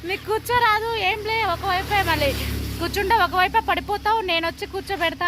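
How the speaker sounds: a lightly voiced anxious little girl; imitating little sister. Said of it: "అంటుంది మా పొట్టి ఏమంటుంది". (చెల్లెలిని అనుకరిస్తూ కంగారుగా)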